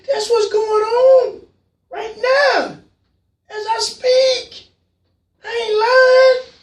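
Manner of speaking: slow, high, shouting through tears; crying shout. Real, not acted. Host insisting to callers it's real, tearful shouting. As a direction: let the grief break the voice as you yell it. Host breaks down over pandemic.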